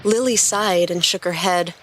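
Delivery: ordinary delivery, nothing marked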